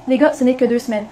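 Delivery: loud call over the others. Acted. Son calming his quarrelling family, loud call. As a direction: raise the voice to cut through the quarrel.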